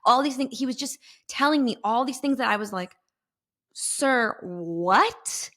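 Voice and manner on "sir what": drawn-out "what" swooping up; stunned, incredulous questioning; baffled disbelief. Retold or imitated. Woman to the all-knowing stranger, bewildered. Her baffled reply, acted.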